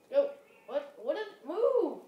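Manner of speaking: high, loud yell. Real, not acted. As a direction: shout at them to move. Urgent yell at team.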